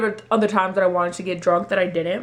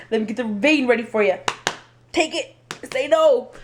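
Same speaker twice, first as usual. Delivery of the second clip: mock command; 4 semitones higher than usual. Mock bossing the blood test.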